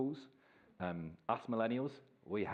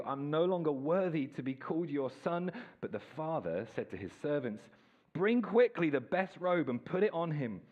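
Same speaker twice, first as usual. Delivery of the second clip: scripture reading; 4 semitones higher than usual. Acted. Reads Luke fifteen aloud.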